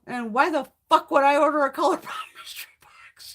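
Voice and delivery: whispered with a laugh breaking in; whispered retort to a friend, laughing; incredulous whisper. Retold or imitated. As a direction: hiss the question like it's absurd. Retold incredulous reply.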